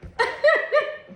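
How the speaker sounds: loud high laugh; high burst of laughter. Real, not acted. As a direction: cracks up laughing. Laughing at her own reading.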